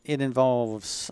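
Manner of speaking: usual speaking voice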